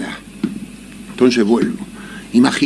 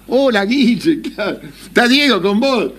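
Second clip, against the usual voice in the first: wife on the phone; 8 semitones higher than usual. Retold or imitated. Wife answers the phone call.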